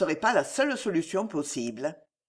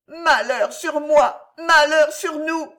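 slow, high, loud lament; anguished cry; 9 semitones higher, 7 dB louder, slower than usual. A narrator's reading. Alice inwardly laments the names revealed, anguished cry. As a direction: wail it out slowly, high and loud. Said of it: "clama la malheureuse au fond de son âme".

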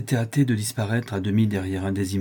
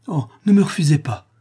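higher, louder imploring burst; emotional pleading; 7 semitones higher, 6 dB louder than usual. A narrator's reading. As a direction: beg with a moved voice. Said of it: "d'une voix émue et suppliante". (voix émue et suppliante)

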